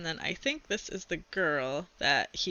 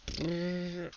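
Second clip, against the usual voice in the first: eerie closet rumble; spooky rumbling brrr; 6 dB quieter than usual. Acted. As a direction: make the closet growl softly. Closet makes disturbing noises.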